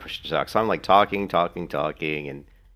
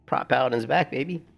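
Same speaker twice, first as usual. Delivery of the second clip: sudden high squeal on sweet, then riding the hype; a gamer hyped his favourite class is back, squealing with joy; excited squeal; 9 semitones higher than usual. Retold or imitated. Gamer recalls his reaction to the tier list, high excited squeal. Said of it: "And I was like".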